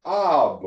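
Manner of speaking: "ab" as one syllable, "ah" closed by b; sounded-out syllable. Shown as a demonstration. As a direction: model "ab" on its own for the learner. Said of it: "you will pronounce this".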